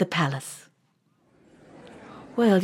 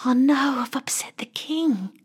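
frightened thought; 3 semitones higher than usual. Acted. Boy feels the king's hand and whispers in fright. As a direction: gasp then whisper in dread. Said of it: "he thinks to himself".

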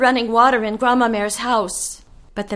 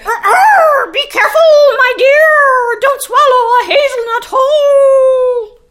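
much higher, louder rooster crying a warning; 8 dB louder than usual. Acted. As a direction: warn her like a worried rooster. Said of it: "I heard the rooster warn her".

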